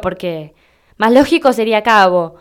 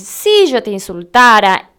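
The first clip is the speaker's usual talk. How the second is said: drawn-out "Si" opening the hypothesis; stressed hypothesis; 4 dB louder than usual. Shown as a demonstration. Teacher explaining an imaginary insult, slow stress on "Si". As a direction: lean slowly on "Si" and "insultara". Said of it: "es una hipótesis".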